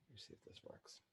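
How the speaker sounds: whispered under the breath; whispering. Real, not acted. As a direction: mutter it to yourself in a whisper. Muttering to self at screen.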